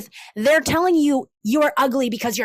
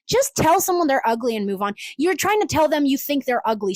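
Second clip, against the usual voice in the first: usual speaking voice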